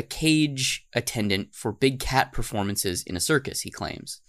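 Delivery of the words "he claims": whispered aside. Acted. Skeptical aside.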